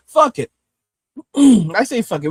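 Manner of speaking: loud, defiant burst on fuck it; fuck it outburst. Real, not acted. Streamer tossing aside the video, loud defiant fuck it. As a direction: throw it away with a loud shout.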